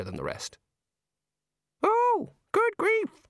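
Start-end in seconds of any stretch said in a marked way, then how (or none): 1.8-3.2 very high, squawky bird voice; chattering birds. A narrator's reading. Birds around a digging man chatter, high-pitched squawk. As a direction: squawk it like a parrot. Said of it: "chattered the birds around him".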